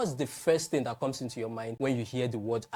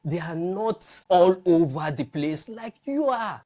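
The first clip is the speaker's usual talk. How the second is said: normal talking voice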